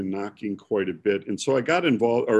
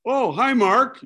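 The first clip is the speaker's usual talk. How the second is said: high, loud sudden greeting; light up as it clicks; surprised recognition; 12 semitones higher, 5 dB louder, slower than usual. Retold or imitated. Preacher recognizing the caller on the phone, surprised high greeting.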